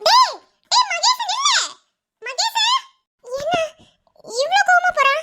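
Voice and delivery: high, slow drawn-out call; calling after him. Acted. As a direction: call him back as he leaves. Wife calls after leaving husband. (பின்னால் கூப்பிடும் குரல்)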